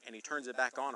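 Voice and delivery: ordinary talk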